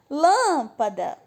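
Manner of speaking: Lâmpada with LÂM loud and drawn out; stress on first syllable. Shown as a demonstration. Teacher calling out another antepenultimate example, stressed Lâmpada. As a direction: stretch the lâm of Lâmpada, then drop away. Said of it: "também é a mais forte".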